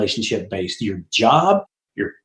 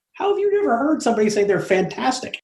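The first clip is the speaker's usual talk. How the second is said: retold disbelief; 9 semitones higher than usual. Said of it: "I'm like".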